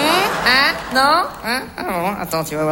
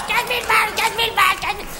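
mock hysterical; 7 semitones higher, faster than usual. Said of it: "complètement hystérique".

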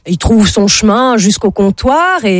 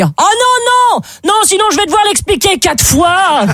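high panicky blind man backing out; blind man panicking; 11 semitones higher than usual. Acted. Blind man backing out of his joke in a high panic. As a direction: squeal it in high alarm. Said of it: "Là, le gars dit".

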